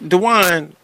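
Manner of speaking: ordinary talk, nothing marked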